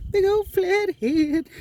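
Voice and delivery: very high, slow crooning over a catch; high drawn-out admiration. Real, not acted. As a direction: stretch it out high, admiring the fish. Admiring the fish he caught.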